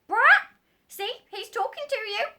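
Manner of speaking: very high, loud parrot squawk. Acted. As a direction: screech like a bossy parrot.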